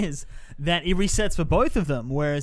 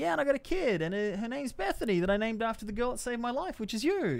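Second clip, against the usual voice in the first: a hint of a proud dad, higher; light, higher character voice; lightly voiced character; 8 semitones higher, 5 dB quieter than usual. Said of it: "And he's like".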